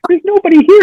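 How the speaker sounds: baffled that the room is empty; mock disbelief.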